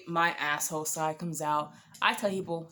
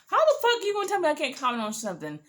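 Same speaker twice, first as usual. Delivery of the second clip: indignant; 8 semitones higher, 4 dB louder, faster than usual. Retold or imitated. Arguing with her date.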